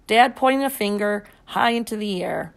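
ordinary delivery

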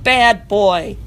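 loud firm scolding. Acted. Miranda scolding her dog loudly. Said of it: "she shouted in a very firm voice".

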